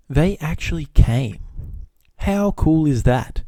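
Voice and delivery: slow, high, drawn-out delight; delighted amazement. Real, not acted. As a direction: savour each word in amazement. Delighted payoff in the story.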